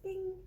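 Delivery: one high, ringing ding; imitated triangle ding. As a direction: chime a single high note like a triangle. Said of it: "I heard, like".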